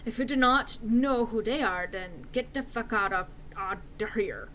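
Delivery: plain reading voice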